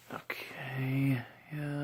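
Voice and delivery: ordinary talk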